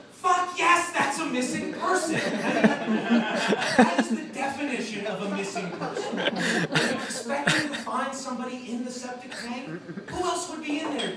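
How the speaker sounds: loud, high, laughing outrage at the report; exasperated shout. Real, not acted. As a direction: yell it out, cracking up at how dumb it is. Mocking the police report.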